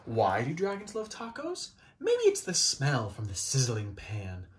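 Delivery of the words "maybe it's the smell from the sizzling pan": usual speaking voice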